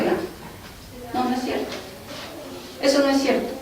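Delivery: breathy whisper, hardly voiced; stunned whisper of denial; whispered disbelief. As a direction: whisper it as if it can't be true. Her stunned reply to God.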